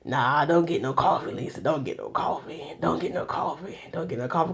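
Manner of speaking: softly whispering to herself not to cave; hushed self-talk. Retold or imitated. Lisa whispering herself out of buying coffee, hushed. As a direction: talks herself down in a near whisper. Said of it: "I was just like".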